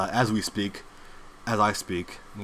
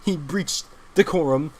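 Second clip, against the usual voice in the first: mock flustered stammer; slower than usual. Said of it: "they come out and they say".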